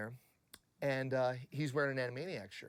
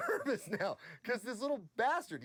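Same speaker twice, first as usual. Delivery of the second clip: normal talking voice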